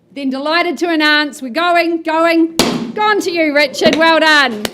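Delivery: loud, triumphant going going gone; excited sold announcement. Real, not acted. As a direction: call the sale with a big finish. Auction hammer falls, sold.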